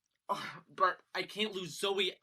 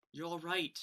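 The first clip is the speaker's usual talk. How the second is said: ordinary delivery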